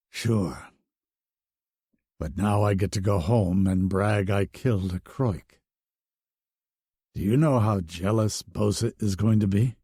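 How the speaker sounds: low, wry wounded joke; lightly voiced hero. A narrator's reading. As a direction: tease her to cheer her up. Hero joking to cheer her.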